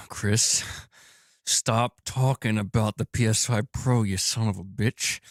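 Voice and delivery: slow, drawn-out mock threat; playful mafia-style telling-off; mock gangster scolding. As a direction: put on a slow mobster reprimand. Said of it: "Gaming Survivor's like".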